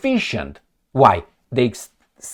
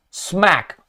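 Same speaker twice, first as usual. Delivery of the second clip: smack; 3 semitones higher, 3 dB quieter than usual. Retold or imitated. Teacher slaps cheeky student.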